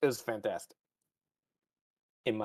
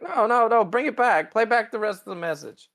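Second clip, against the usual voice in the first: higher, rushed desperate nos; mock frantic plea; 9 semitones higher than usual. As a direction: grab at it before it's gone. Pike begs transmission back.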